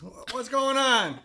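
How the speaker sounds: mock-confused husband, very high and loud; loud, high imitation of eternal confusion; John. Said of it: "Poor John's always eternally confused".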